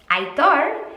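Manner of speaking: Aitor said with a Basque ring, lifted at the end; Basque name Aitor. Shown as a demonstration. Naming her favourite Basque name, said carefully. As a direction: say Aitor the Basque way, eye-TOR. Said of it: "a name from the Basque Country".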